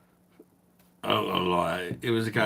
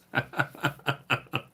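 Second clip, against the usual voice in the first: breathy high laugh; 7 semitones higher than usual. Real, not acted. Laughing at Louis Spence joke.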